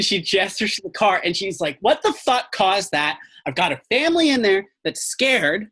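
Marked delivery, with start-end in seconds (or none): 1.8-5.7 higher-pitched indignant outburst; acted outraged park ranger; irate ranger. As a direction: demand answers, alarmed and angry. Angry ranger confronts the agents.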